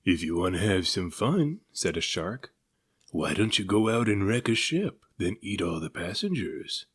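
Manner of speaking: a lightly voiced tempting shark; a hint of a shark egging him on; sly shark. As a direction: coax him toward mischief.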